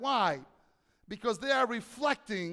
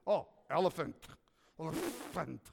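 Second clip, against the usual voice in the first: lower, savoring the new name; God pleased, lower; 5 semitones lower, 4 dB quieter than usual. Pretend. God names the elephant, lightly voiced satisfaction. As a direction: try the word out, pleased with it.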